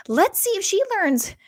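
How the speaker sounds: high, loud, slow singsong taunt; taunting parents. Retold or imitated. Parents taunting Michelle in keep away, mocking singsong. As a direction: tease her like a playground bully.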